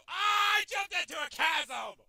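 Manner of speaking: high, loud shout; real exasperated outburst; frustrated wail. Real, not acted. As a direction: yell out your own blunder. Wailing at own mistake.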